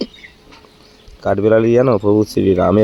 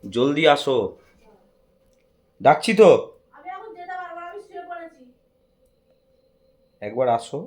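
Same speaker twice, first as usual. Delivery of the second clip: higher, sing-song calling; 4 dB quieter than usual. Real, not acted. Husband calls his wife to come see the gift, calling out higher. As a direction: call her from the other room.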